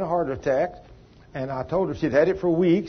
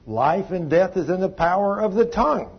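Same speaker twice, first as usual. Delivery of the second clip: ordinary talk, nothing marked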